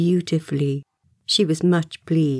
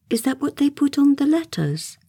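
much higher, polite enquiry; nice; 8 semitones higher than usual. A narrator's reading. Wendy as hostess asking about his address, nice. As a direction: ask sweetly, as a careful hostess. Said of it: "Wendy said nicely".

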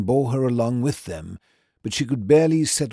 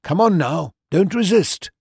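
higher, louder urging; warning; 7 semitones higher, 5 dB louder than usual. A narrator's reading. Watson urging Wolfgang on, high and loud. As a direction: press the lad to stop resisting. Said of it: "I warned him".

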